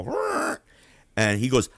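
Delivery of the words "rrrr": high, mock chair-scrape noise. Storyteller imitating the chair, scraping noise. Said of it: "you heard that chair go".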